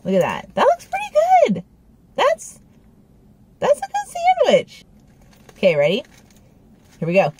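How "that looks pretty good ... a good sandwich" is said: high, squeaky delighted praise; excited squeal. Real, not acted. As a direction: gush over the sandwich. Admiring the sandwich up close.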